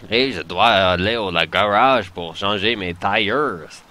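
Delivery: slow, exaggerated Quebecois accent. Gamer teasing viewers with a Quebecois accent impression, exaggerated and slow. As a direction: drag out the words like a thick Quebecois speaker. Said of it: "Have you heard Quebecois accents?".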